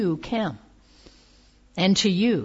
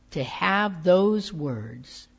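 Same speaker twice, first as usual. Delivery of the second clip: ordinary delivery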